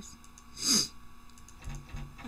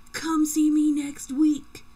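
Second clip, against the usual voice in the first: slow, higher, louder insistence; pushy demand; 6 semitones higher, 10 dB louder, slower than usual. Acted. Boyfriend pushing Elsa to visit, slow and insistent. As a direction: press her hard to come, drawn out. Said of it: "you sound kinda pushy".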